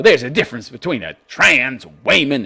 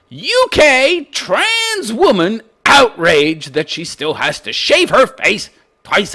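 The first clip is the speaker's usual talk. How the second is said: mocking headline read; 6 semitones higher, slower than usual.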